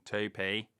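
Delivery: key phrase in Northern Irish; put-on Northern Irish twang; Northern Irish accent. Shown as a demonstration. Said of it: "if you want Northern Irish".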